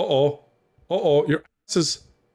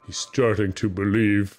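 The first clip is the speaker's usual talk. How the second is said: Matrix movie quote; 6 semitones lower than usual. Retold or imitated. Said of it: "Is that Matrix?".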